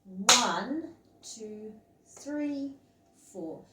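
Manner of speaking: ONE loud, THREE lightly stressed; strong first beat. Shown as a demonstration. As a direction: count with a big accent on One and a smaller lift on three. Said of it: "it would sound like this".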